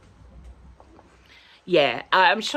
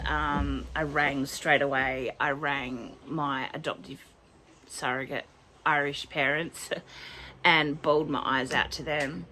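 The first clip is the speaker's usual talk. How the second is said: ordinary talk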